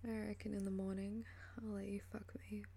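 ordinary delivery

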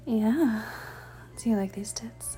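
ordinary delivery

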